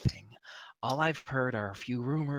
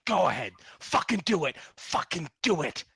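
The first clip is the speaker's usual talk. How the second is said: loud, high, daring shout; defiant dare; 6 semitones higher, 6 dB louder than usual. Acted. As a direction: dare him to do it. Captive daring torturer.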